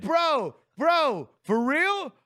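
loud, high mock urgency; fake phone call. Faking a call from a bro to change seats, hammed-up. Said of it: "make a fake phone call and just go like".

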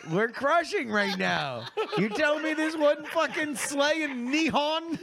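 gloating. Pretend. Slow, higher gloating.

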